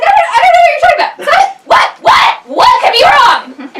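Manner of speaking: very high, very loud, rushed squawk; hysterical bird. Acted. Bird squawks in frantic denial, very high and loud. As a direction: shriek it out in full panic. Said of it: "the bird is like".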